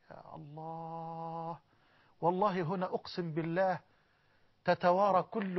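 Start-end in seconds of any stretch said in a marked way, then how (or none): 0.1-1.6 long breathy near-silent sigh of awe; awed sigh. Real, not acted. Preacher overwhelmed by the angel's greeting, a drawn-out sigh of awe. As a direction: let the name fade out slowly.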